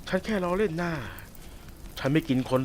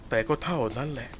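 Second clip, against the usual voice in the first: ordinary talk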